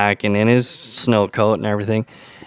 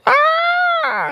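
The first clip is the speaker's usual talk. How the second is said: short scream, pitched way higher; retold child scream. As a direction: imitate the kid's sudden scream. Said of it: "I hear this blood-curdling scream".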